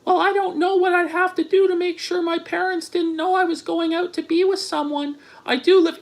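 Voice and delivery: high-pitched put-on woman's voice; mocking falsetto reading; mock girlfriend voice. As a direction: imitate a girl texting, high and even. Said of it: "She goes".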